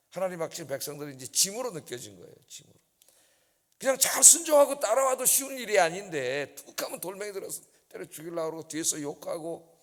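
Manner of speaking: ordinary delivery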